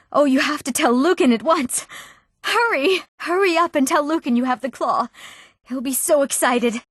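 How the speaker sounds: high-pitched, delighted rush of news; excited urging. Acted. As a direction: urge them on, thrilled. Claw found, urging to tell Lucan.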